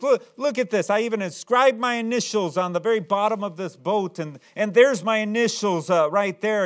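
put-on high, loud, insistent child's voice; insistent boy. Boy showing the shopkeeper his initials, high insistent plea. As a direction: prove it's yours, voice high and loud.

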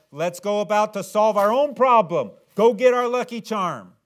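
high, loud mock scheming crowd; loud put-on eager Israelite voice. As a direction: play the people grabbing their charm. Israelites voiced fetching lucky charm.

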